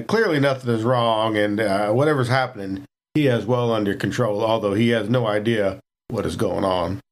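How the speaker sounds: normal talking voice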